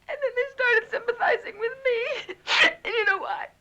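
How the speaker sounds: crying as she explains; distraught, sobbing account; tearful distress. Acted. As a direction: struggle to get it out through tears. Tearfully explaining brother's possession.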